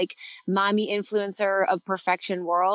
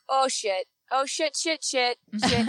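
put-on toddler voice; 4 semitones higher, slower than usual. Toddler cursing, voiced by mom.

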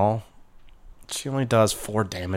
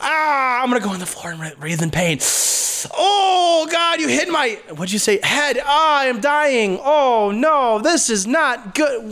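high, hammed-up dying wail; fake agony; 13 semitones higher than usual. Game master as grunt pretending to die, fake agony. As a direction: moan and wail as if mortally wounded.

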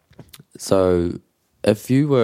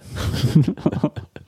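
high breathy burst of laughter; amused, high-pitched laughing fit; 8 semitones higher than usual. Real, not acted. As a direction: laugh at your own joke. Amused at being asked if tired.

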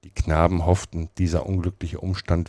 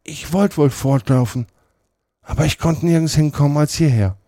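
höher, klagend; weinerlich; 10 semitones higher, 5 dB louder than usual. A narrator's reading. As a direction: klag es hilflos. Potter klagt weinerlich. (Potter klagt weinerlich und höher)

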